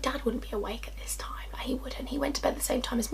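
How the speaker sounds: hushed whisper, nearly inaudible. Retold or imitated. Girl listening from upstairs to voices below, whispering to herself. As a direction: drop right down into a secretive whisper. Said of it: "why am I whispering?".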